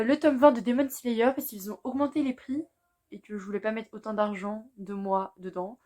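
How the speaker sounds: ordinary delivery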